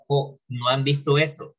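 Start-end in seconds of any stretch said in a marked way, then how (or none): 0.1-0.4 "jo" as a short Spanish-style ho; students' mispronunciation. Retold or imitated. Teacher imitating students who misread how as "jo", mispronounced. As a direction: imitate the mistaken "jo", with no w glide. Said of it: "algunos todavía me pronuncian jo".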